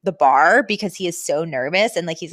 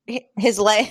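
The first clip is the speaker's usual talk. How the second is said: ordinary talk, nothing marked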